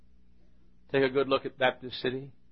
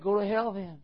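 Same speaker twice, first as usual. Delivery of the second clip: higher-pitched lisping retort; imitated speech impediment; 9 semitones higher than usual. Church nut answering banker's refusal, high lisping voice. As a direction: mimic the lisping man's comeback. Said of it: "he, he, he, he talked like this".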